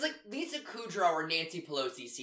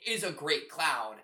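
ordinary delivery

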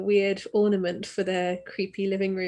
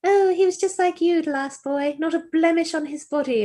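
put-on creepy old landlady; 7 semitones higher, 3 dB louder than usual. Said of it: "And she's like".

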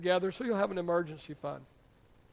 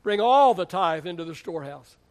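"all" stretched and louder than the rest; stress on ALL; 5 dB louder than usual. Acted. Pastor corrects giving only part, "all" long and loud. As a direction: punch "all", then let it fall away.

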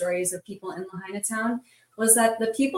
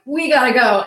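retold urgent shout, louder and higher; urgent call; 4 semitones higher, 9 dB louder than usual. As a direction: call it out like you must flee now. Urgent retold order to flee.

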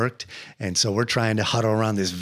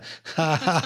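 put-on joking "ha ha"; joking laugh; 5 semitones higher than usual. Retelling his own joke to a friend, joking laugh. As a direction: fake a jokey little laugh. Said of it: "you know, joking".

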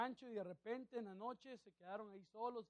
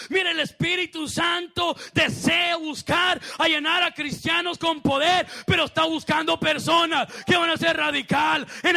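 raised pitch, building to a loud shout; fervent preaching; 7 semitones higher, 16 dB louder than usual. Real, not acted. Preacher challenging believers who would leave for the casino, building shouted preaching. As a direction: challenge the congregation, louder with every phrase.

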